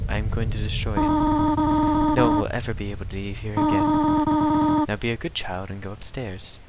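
lightly voiced motherly goat queen, soft and slow; a hint of a stern mother; Toriel voice. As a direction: read it like a gentle but firm guardian. Reads Toriel's lines in voice.

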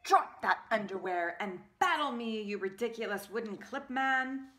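loud, high taunting challenger voice; brash clothespin hero voice. Acted. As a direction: taunt him like a cocky wooden clip man.

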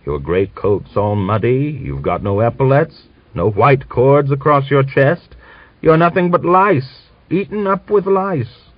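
old man mocking Grigor's muddy coat; scornful. A narrator's reading. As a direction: jeer at the shabby general.

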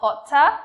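loud "Potter" with rising ending; rising intonation. Shown as a demonstration. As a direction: push "Potter" up. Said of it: "lên giọng cuối câu".

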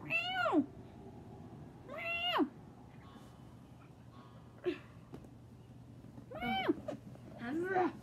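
very high, squeaky pretend meow; a child playing the farm cat, meowing high; kitty meow. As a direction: mew like a little cat.

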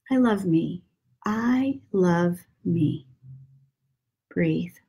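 gentle breathing-guide murmur; guided meditation. Acted. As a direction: ease them into breathing, hushed and unhurried. Leads mantra and breathing.

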